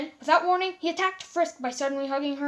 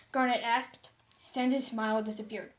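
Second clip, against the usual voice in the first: ordinary delivery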